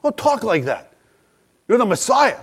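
high, hushed protest to a respected teacher; Peter. Retold or imitated. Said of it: "Peter doesn't like this talk".

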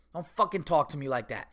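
normal talking voice

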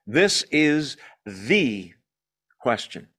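This is the... question, drawn out with a pause before question; slow pause-laden stress. Acted. Preacher driving home the one question that matters, slowly. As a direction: let "This" hang, then drop onto "question".

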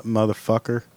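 ordinary delivery

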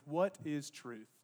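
low, slow and soft; understated, pensive question; quiet parting question. Acted. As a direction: let it fall slowly, almost to yourself. Pilate's parting question, retold.

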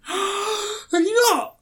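high, slow gasping disbelief; shocked gasp. Real, not acted. Shocked he hasn't seen it.

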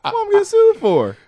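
mock indignant. Mock-indignant as Miss Cleo.

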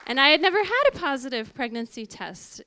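ordinary talk, nothing marked

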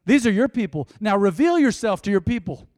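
loud, forceful preacher's prayer; fervent prayer. Real, not acted. Preacher praying over his people, loud and fervent. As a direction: call out to God at full force.

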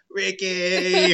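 high, loud sitcom wife cry; Lucille Ball. Retold or imitated. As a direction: wail the name like a shocked fifties sitcom wife. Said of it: "I was doing the Lucille Ball".

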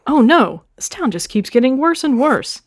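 a startled oh no, then sinking worry; gasped dismay at the town; gasping dismay. A narrator's reading. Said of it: "Tom Nook gasped".

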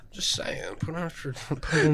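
whispered aside. Real, not acted. Friend adding a sly whispered aside. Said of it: "whispered aside".

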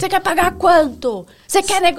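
retold angry shouting. Vendor confronting a customer in his shop, mock shouting. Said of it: "Gritando na loja".

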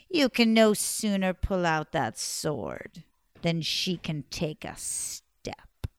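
slow taunt sinking to a whisper; slow and low, then breathe the end; sinister crone. Acted. Old woman taunting Simon, slow, ending in a whisper.

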